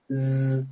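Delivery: "Z" as a sustained buzz; vibrating Z. Shown as a demonstration. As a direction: hold "Z" as a voiced hiss. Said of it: "que vibraban". ("Z" sonora y vibrada)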